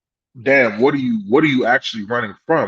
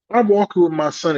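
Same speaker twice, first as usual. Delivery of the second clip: normal talking voice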